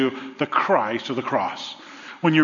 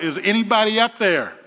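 higher mock call for help; 6 semitones higher than usual. Man hanging off a cliff calls upward, higher mock call. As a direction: call up from the cliff, half acted. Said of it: "he calls out".

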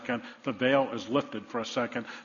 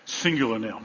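ordinary delivery

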